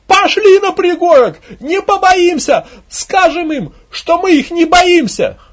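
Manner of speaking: high, loud, drawn-out yelling; woman's rousing cry. Acted. Woman urging crowd to the hill, shouting. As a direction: urge everyone on at the top of your voice. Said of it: "женский крик".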